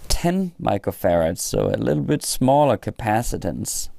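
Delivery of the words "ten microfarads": ordinary talk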